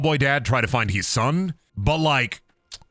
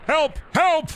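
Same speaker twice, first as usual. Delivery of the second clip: high, short mock yelps; mock cry for help. Gamer toying with fleeing enemies, mock cry for help. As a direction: squeal out a playful distress call.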